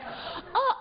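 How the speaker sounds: high, hushed dismay as a Korean salon worker; imitating an overwhelmed Korean beautician; Korean salon lady. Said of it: "the Korean lady, and she's like".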